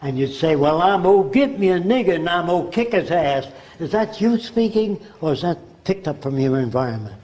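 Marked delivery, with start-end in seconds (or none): 0.5-3.5 put-on lightly done Southern American accent. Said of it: "you speak with a Southern accent".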